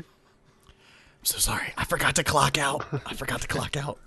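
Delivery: hushed, breath-only confession played for laughs; whisper. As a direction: whisper it like a sheepish assassin. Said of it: "you hear him whisper".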